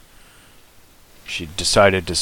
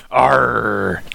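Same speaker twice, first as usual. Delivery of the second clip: pirate growl; 4 semitones higher, 3 dB louder than usual. Pretend. Pirate Captain Hook aside.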